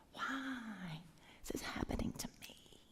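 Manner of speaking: Job whispering his complaint; hushed, hurt question. Acted. Job whispering his pain to God, hushed whisper. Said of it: "What if Job came to God".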